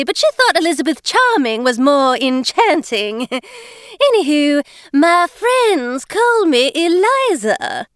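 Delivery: high, overpronounced fake British voice with dropped Rs; a high, girlish put-on British act, words overpronounced; faux-British Eliza. Scene: Eliza cheerfully greets newcomer Jane, high fake-British girlish voice. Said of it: "she was attempting to sound British".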